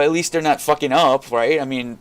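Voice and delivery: usual speaking voice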